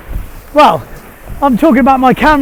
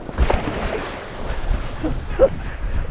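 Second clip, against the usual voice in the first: airy laugh after the fall; breathless laugh; 9 semitones higher, 8 dB quieter than usual. Real, not acted. After the failed jump.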